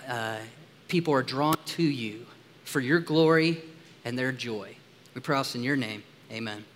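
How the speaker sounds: low, soft praying voice with pauses; prayer. Real, not acted. Pastor praying to God before preaching on the text, hushed prayer. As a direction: pray quietly and earnestly, pitch dropping.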